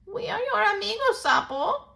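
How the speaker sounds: high, quick reply; old toad reassuring Sapo. Acted. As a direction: answer as a loyal old friend.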